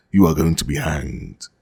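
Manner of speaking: ordinary delivery, nothing marked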